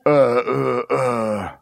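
hammed-up straining wail; a mock cry of a mother in labour; preacher imitating the poor mother's strained crying; mock birth cry.

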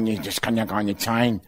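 low, hushed put-down voice; disparaging inner voices. Acted. Voices in his head belittling him, low dismissive mutter. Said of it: "dann hab ich Stimmen gehört".

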